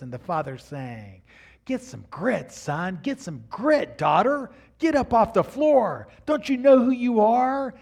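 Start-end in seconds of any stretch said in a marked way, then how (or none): 1.7-7.7 building from firm to loud, high call; loud divine pep talk; rising fatherly charge. Acted. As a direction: rouse them off the floor. Voicing God the Father's exhortation.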